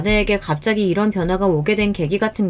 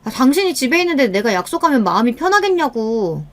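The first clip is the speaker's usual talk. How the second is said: higher, exasperated retort; irritated wife; 5 semitones higher than usual. Acted. Wife reproaching husband about her dinner plans, irritated. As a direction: complain in rising annoyance. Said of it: "그런 걸로 짜증내는".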